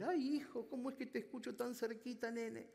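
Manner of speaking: put-on high, puzzled old mother; old mother's voice. Mother wondering why her son sounds so close, high imitating voice. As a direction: play her as a doting, confused mom. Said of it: "dijo la mujer".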